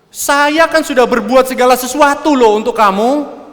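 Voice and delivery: loud, high-pitched self-righteous reproach; high, heated accusing rant; indignant complaint. Acted. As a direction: act out an aggrieved husband listing all he has done. Self-righteous complaint to wife, acted.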